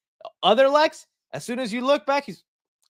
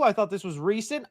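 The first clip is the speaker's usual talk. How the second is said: ordinary talk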